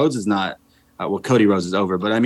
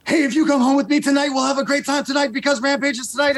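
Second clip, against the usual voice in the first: much higher, fast put-on voice for a pickup line; cheesy pickup line; faster than usual. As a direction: ham up a fake smooth come-on, high and quick. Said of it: "I should have been like".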